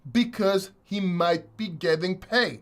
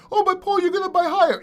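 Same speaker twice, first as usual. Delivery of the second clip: high, mocking voice of a doubter; mocking whiny viewer; 9 semitones higher, 4 dB louder, faster than usual. Crypto YouTuber mocks a viewer's objection, high and mocking. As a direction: mimic the doubter to ridicule him.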